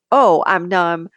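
ordinary talk

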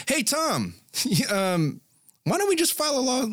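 high, eager junior lawyer; chirpy underling asking; young associate voice. Retold or imitated. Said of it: "he'd be like".

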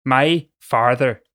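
slow, drawn-out attempt at a Northern Irish accent. Shown as a demonstration. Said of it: "I just need to get into character".

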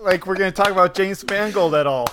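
high, loud, baffled retelling; excited disbelief. Retold or imitated. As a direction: act amazed and confused. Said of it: "I was like".

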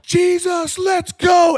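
high, loud announcer yell; hype shout. Retold or imitated. Announcer-preacher rallying five hundred kids, big high shout. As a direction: fire up the room like a stadium. Hyping the crowd.

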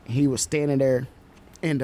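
normal talking voice

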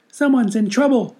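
high squeaky mouse. Acted. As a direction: squeak it like a worried little mouse. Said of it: "squeaked the mouse".

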